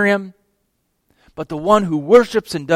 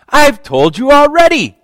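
raised, loud, fed-up retort; eye-rolling exasperation; 8 semitones higher, 6 dB louder than usual. Acted. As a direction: toss it back with an exasperated eye roll. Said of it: "He rolls his eyes".

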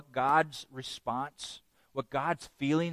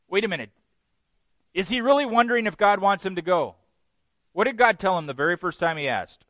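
ordinary talk, nothing marked

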